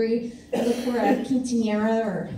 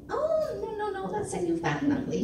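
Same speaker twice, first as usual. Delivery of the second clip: high-pitched, falsely apologetic correction; mock-sympathetic translation; 10 semitones higher, 4 dB quieter than usual. Faking a translation for a snotty cheerleader, high mock sympathy. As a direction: break bad news in a put-on high voice.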